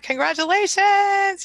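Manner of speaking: slow, high, joyful congratulations; excited congratulations. Real, not acted. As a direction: celebrate her win out loud. Congratulating a viewer's weight loss.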